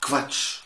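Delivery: كفاتش said breathily, K then V; KV in Quatsch. Shown as a demonstration. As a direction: say كفاتش with a KV start. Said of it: "أشهر كلمة نعرفها كفاتش". (كفاتش بنطق ألماني)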